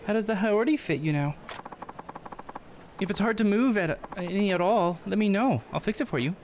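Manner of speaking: high-pitched, eager-to-help character voice; game character, helpful. Acted. Character checking how the Haori fits, high helpful voice.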